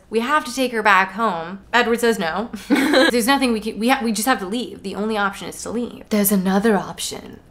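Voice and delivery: ordinary delivery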